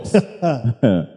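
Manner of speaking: low, soft chuckle; quiet, contented little laugh. Real, not acted. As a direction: laugh briefly under the words. Preacher chuckles after his point.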